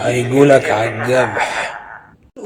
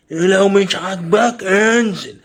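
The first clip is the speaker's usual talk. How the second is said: forceful dismissal; 6 semitones higher, 4 dB louder, much slower than usual. Acted. Acted quarrel, ordering the other out.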